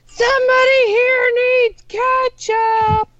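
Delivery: high, drawn-out holler across the restaurant; hollering waitress. Acted. Waitress calling out for ketchup, hollering it. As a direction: bellow it slowly to the whole room. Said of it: "Then they holler".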